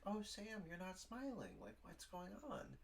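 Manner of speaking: high, softened, cooing boss voice; imitating the boss. Boss checking on an unsmiling employee, high-pitched imitation. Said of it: "little comment from my boss".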